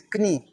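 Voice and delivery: "knie" with the K sounded before N; German-style K. Shown as a demonstration. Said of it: "Like German language".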